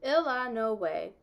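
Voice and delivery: "Il a no way" as three clear syllables ill-a-no-way; Kentucky pronunciation. Shown as a demonstration. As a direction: say "Il a no way" the Bybanks way, first syllable stressed. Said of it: "exactly the way everyone in Bybanks, Kentucky pronounced it".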